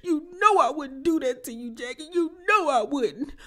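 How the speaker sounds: high, wailing mock sob; hammed-up fake crying plea. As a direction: overdo the crocodile tears. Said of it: "And Quad fake crying".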